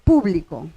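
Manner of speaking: Público with the weight on the first syllable, PÚ-blico, said low; teacher models PÚ-blico for the class; stress on público. Shown as a demonstration. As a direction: land hard on the PÚ of Público. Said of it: "Yo puedo decir público".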